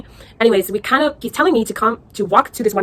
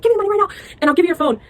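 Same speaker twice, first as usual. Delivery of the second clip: imitating an angry driver, pitched much higher; mock angry demand; 10 semitones higher, much faster than usual. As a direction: play the furious taxi driver demanding cash. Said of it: "He's really angry".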